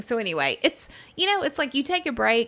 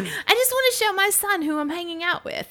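imitating British guy; 10 semitones higher than usual. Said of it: "He's like".

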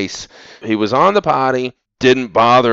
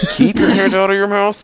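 mock parent shout; 9 semitones higher than usual. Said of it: "I'm just like".

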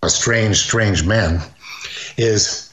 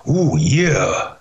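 lightly voiced raspy catchphrase; hint of the wrestler's tag line; Macho Man oh yeah. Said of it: "borrow Oh Yeah".

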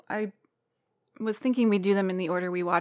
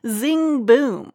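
loud, higher zing boom; punchy intro sting; 5 semitones higher, 9 dB louder than usual. Shown as a demonstration. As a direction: hit it like a sting. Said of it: "why I would have said zing boom".

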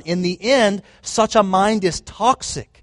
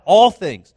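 "all things" punched louder, a bit higher; stress on all things; 3 semitones higher, 6 dB louder than usual. Shown as a demonstration. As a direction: hit "all" hard, then let "things" fall. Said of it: "note the emphasis".

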